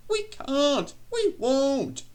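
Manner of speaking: very high, loud squealed protest; screaming trucks. A narrator's reading. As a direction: scream it like panicking little trucks. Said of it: "they screamed".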